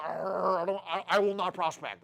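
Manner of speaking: mock grumbling old-timer, low; a grouchy older recruit refusing; lightly voiced grumpy old salesman; grumpy old man. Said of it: "old farts that come in here like".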